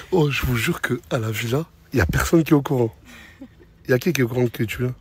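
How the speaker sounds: ordinary talk, nothing marked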